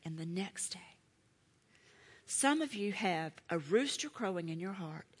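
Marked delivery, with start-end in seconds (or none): 0.0-0.8 whispered. Shown as a demonstration.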